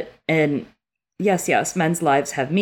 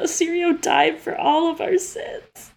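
mock solemn lament; 14 semitones higher, slower than usual. Real, not acted. Mock solemn emotional declaration.

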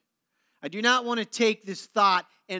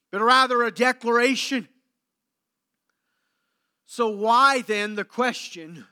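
ordinary talk, nothing marked

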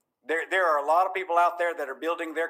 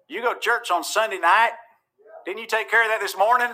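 raised, louder mock challenge; Pharisee; 7 semitones higher, 5 dB louder than usual. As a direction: needle them like a disapproving churchgoer. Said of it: "that was my Pharisee coming out".

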